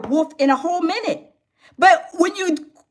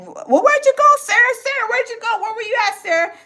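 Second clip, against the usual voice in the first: friend calling out; 5 semitones higher than usual. Retold or imitated.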